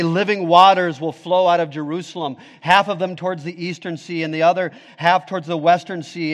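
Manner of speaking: ordinary talk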